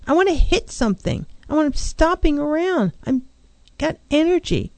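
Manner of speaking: a hint of someone itching to hit something; mild acted anger. Shown as a demonstration. Counselor voicing an angry client's urge, mild mock anger. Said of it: "we take that energy and we swallow it".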